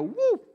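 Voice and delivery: very high, loud whoop sound effect; imitated slackening whoop. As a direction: shoot the voice way up on a quick whoop. Mimics faith suddenly going slack.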